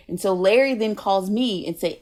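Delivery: ordinary talk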